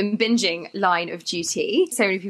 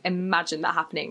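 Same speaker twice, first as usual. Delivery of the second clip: ordinary delivery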